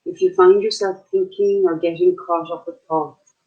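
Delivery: unhurried meditation guide, long pauses between phrases; short, spaced-out calming instructions; guided meditation. Acted. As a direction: guide listeners gently into their breath.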